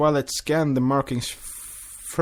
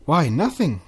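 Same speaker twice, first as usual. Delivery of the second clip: innocently; 5 semitones higher, 6 dB louder than usual. Acted. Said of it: "Cadance said innocently".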